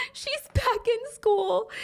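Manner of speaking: high, slow voice cracking with tears; choked up. Real, not acted. Teller choking up while recounting the sad ending, crying. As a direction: speak through real tears. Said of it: "she's really tearing up".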